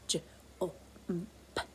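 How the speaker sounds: soft whispered j, o, m, p; whispered sounds. Shown as a demonstration. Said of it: "Frank the Fox says".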